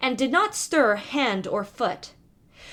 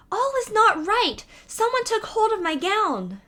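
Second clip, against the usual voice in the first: much higher, startled outcry of a frightened princess; alarmed cry; 10 semitones higher than usual. Acted. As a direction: cry out in alarm to her sisters. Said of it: "and she cried out to her sisters".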